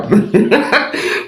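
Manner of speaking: high breathy giggle; laughing. Real, not acted. Host amused by a caller's question, giggling.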